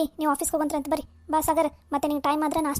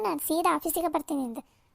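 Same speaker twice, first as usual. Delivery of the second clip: usual speaking voice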